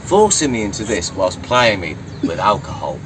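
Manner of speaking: ordinary delivery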